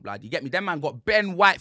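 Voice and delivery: normal talking voice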